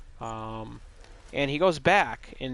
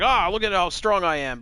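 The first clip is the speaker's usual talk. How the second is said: higher, louder show-off voice; mock boasting; 6 semitones higher, 5 dB louder than usual. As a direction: imitate a hero showing off, lightly hammed-up. Hero shows off his strength.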